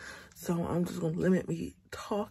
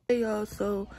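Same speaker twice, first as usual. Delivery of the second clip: normal talking voice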